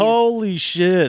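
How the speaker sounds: slow, drawn-out holy shit; real stunned reaction; shocked. Real, not acted. Reacting to Israel Keys house.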